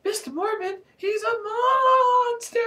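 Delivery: frantic high-pitched cry; panting repeat. A narrator's reading. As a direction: wail the warning in a high squeal. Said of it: "I repeated, panting like a dog".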